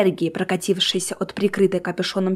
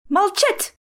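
angry order; 12 semitones higher than usual. A narrator's reading. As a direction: demand silence in a sudden high burst. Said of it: "топнул ногой Джоплин". (гневный приказ на высокой ноте)

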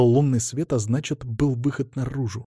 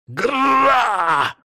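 high roaring shout; feral roar; 12 semitones higher, 3 dB louder than usual. A narrator's reading. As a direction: roar like a beast. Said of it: "снова зарычал парень".

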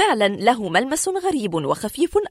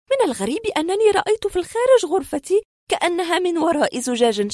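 higher-pitched woman's voice recounting an uncanny experience; distressed woman patient; 7 semitones higher than usual. Acted. Woman tells doctors of the strange door, high earnest voice. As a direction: play the patient pleading her strange story.

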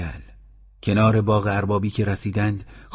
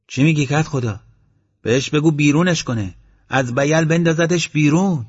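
higher, louder, heated objection; indignant protest; 7 semitones higher, 6 dB louder than usual. Acted. Esmail protesting to the headman, heated and loud. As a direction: push the headman hard, outraged. Esmail urging to throw dog out.

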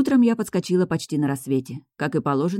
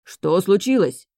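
loud, drawn-out anxious question; worried; 4 semitones higher, 5 dB louder, slower than usual. A narrator's reading. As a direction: ask with raised, slow concern. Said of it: "озабоченно спросил староста". (озабоченно, встревоженно)

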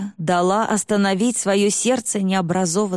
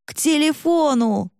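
shouting; 7 semitones higher than usual. A narrator's reading. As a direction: shout it together, urgent. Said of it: "хором крикнули оба".

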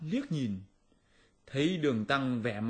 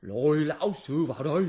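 loud barking demon command; furious shout; 3 semitones higher, 6 dB louder than usual. Acted. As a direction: roar the order at the minions. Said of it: "nó đùng đùng quát to dọa nạt".

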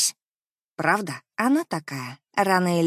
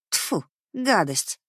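disgusted; much slower than usual. Acted. Narrator spits in disgust.

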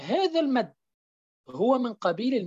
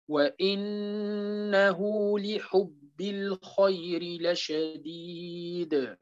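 slow, soft tajweed chanting; Quran recitation; 3 semitones lower, 4 dB quieter, much slower than usual. Acted. Teacher recites surah al-Adiyat to students, slow soft chant. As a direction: recite the verses slowly and quietly.

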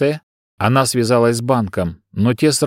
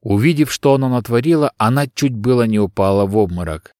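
usual speaking voice

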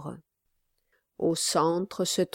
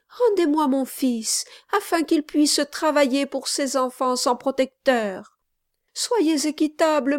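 higher, tearful plea; pleading mother; 8 semitones higher, 4 dB louder than usual. A narrator's reading. Mother begs the gentleman to return her son, high and pleading. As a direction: beg him for her son one last time.

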